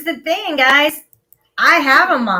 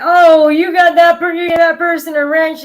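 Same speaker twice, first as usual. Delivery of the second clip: whiny accuser; 6 semitones higher, 8 dB louder than usual. Retold or imitated. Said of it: "people start getting".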